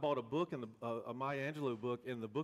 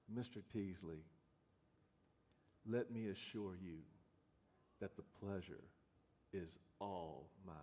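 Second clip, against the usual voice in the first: Maya Angelou imitation; 6 semitones lower, 10 dB quieter than usual. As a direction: draw each phrase out, grand and low, a regal elder honoring a guest. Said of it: "I'll never do a great Maya Angelou imitation, but I'm gonna try".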